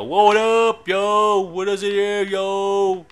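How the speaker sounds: high, drawn-out "yo" greeting; hyped-up call. Real, not acted. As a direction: sing out a hyped, stretched greeting. Hyped call on entering friend's house.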